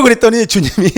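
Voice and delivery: ordinary talk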